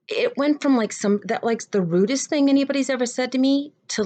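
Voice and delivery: high-pitched retold disbelief; amazed. Playwright sums up an odd conversation, amazed.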